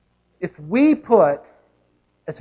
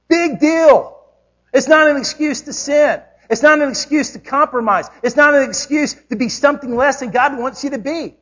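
much higher and louder, hammering each NOT; preaching at full force; 8 semitones higher, 6 dB louder than usual. Acted. Preacher rebuking the congregation, loud and high. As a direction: drive it home like a revival preacher.

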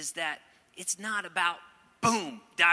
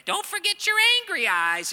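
much higher, louder cartoon wife's scolding voice; Mrs. Potato Head; 10 dB louder than usual. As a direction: squeak it out like the cartoon potato wife sending her husband off. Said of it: "the part in Toy Story where the potato head".

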